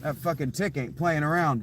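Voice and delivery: ordinary talk, nothing marked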